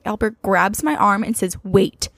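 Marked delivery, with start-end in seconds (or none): none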